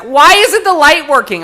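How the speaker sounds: imitating the girl asking about the light, whiny and loud; whiny complaint. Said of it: "And she's like".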